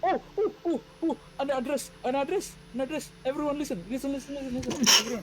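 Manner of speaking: high, eager calling out to everyone; rally the crowd, breathless and high; excited announcement. Acted. NPC announces address excitedly.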